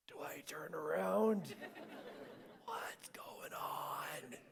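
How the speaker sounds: slow, hushed, frightened whisper; acted-out whisper of dread; scared whisper. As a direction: whisper it slowly, afraid to turn around. Retold terror, dog growling behind him.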